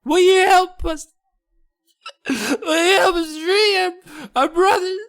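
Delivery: very high squeaky voice, begging and tearful; tiny gnome on the verge of tears; high whiny gnome plea. Acted. Gnome asks for help.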